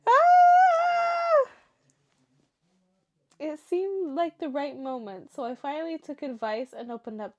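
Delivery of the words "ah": high, loud, drawn-out squeal; long emotional shriek; overcome squeal. Real, not acted. Reader hearing brother would always defend him, high squeal.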